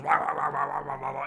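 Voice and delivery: loud run of repeated wahs; mock squawking. Penguin rallying his troops of penguins, mock squawking. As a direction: squawk out a string of wahs, loudly.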